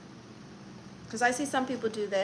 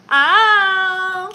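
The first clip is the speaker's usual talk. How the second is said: sharp loud ow of pain; sudden cry of pain; pained yelp; 8 semitones higher, 14 dB louder than usual. Real, not acted. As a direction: yelp out as it hurts. Piercing pain yelp mid-stream.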